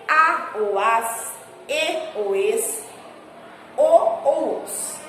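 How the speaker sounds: a ou as, e ou es, o ou os read very slowly; slow spelled-out endings. Shown as a demonstration. Teacher listing accented endings for pupils, very slow. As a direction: lay out each ending slowly. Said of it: "São acentuadas as palavras".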